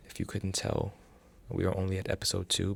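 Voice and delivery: normal talking voice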